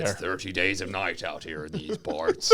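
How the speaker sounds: low, quiet mock-ominous storyteller; grizzled trucker. Trucker telling of endless night, mock-ominous. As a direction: drop low and tell it like grim lore. Trucker as grim old legend teller.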